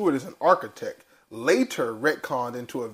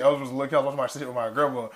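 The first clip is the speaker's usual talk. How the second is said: ordinary delivery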